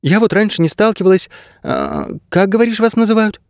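put-on high young heroine voice; inquiring girl. A narrator's reading. Girl asking the dog what his kind are called, inquiring. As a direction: admit it, then ask high.

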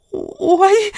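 high startled yelp; squeaky little cry of alarm; frightened cry. A narrator's reading. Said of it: "вскрикнула продавщица".

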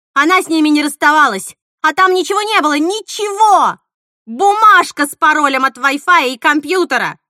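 loud, high-pitched furious tirade; frantic shouting. A narrator's reading. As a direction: rant at full voice, then order. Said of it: "закричала Мила". (срываться на громкий яростный крик)

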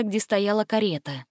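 usual speaking voice

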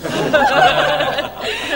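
airy burst of laughter; breathy laugh. Acted. Aunt laughing at her Saint Peter joke.

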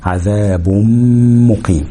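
"adhabum-muqim" with a nasal hum; merging with ghunna. Shown as a demonstration. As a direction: hold a long m between عذاب and مقيم. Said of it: "أدغم الميم دي في الميم دي بغنة".

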